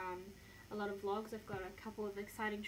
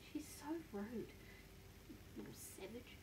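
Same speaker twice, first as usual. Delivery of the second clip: mock-scolding whisper; 2 semitones higher, 9 dB quieter than usual. Real, not acted. Owner teasing her dog in a whisper. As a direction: hiss it fondly at the sleeping dog.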